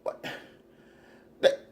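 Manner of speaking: ordinary delivery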